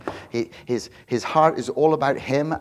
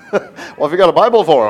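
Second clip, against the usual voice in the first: loud, higher imitation of a baffled friend; mock incredulous outburst; 5 semitones higher, 13 dB louder than usual. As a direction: blurt it as a disbelieving mate. Friends react to Bible in bar.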